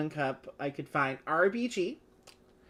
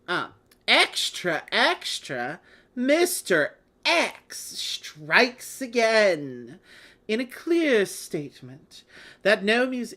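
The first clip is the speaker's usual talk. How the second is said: mock British newsreader accent, slow and dramatic; hammed-up British news crier, drawing the words out; a playful attempt at a British accent; bad British accent; 5 semitones higher, 5 dB louder, slower than usual. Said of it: "do a bad British accent".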